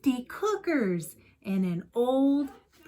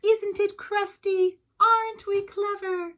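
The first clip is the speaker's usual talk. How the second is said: much higher and louder, thrilled and boastful; proud villagers' shout; 12 semitones higher, 5 dB louder than usual. Acted. The villagers cheer over their giant loaf, high proud shout. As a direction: crow about it like delighted villagers. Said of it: "the villagers raised a mighty shout".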